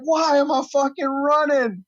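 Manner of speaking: high, drawn-out mock complaint; slow, high-pitched retold whine of protest; bewildered protest. Retold bewildered protest while running.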